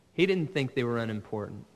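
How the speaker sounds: ordinary delivery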